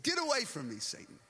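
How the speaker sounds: acted-out sharp dismissal; stern rebuke. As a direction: order him away firmly. Jesus's rebuke of Peter, acted.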